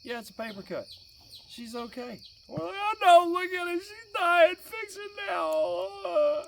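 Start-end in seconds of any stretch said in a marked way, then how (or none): 0.0-2.2 softer, matter-of-fact reply; unconcerned doctor. Retold or imitated. Calm doctor answers panicking dad. 2.8-6.4 high wail, last words drawn out; hysterical mock crying. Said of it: "we're over there in tears".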